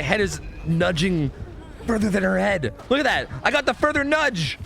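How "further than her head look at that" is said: ordinary delivery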